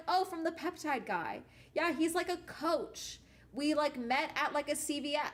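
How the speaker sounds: friend voiced high and bright, a ditzy mock tone; imitating the airy friend. Friend explaining where she got peptides, ditzy mock voice.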